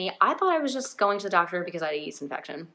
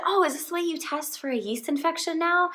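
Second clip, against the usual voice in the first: high-pitched innocent question, imitated; mock-innocent, voice lifted well up; naive question; 9 semitones higher than usual. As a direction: ask it high and guileless. Acting out her naive question.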